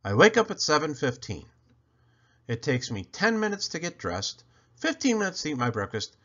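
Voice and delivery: usual speaking voice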